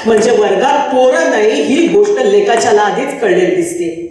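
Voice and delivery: usual speaking voice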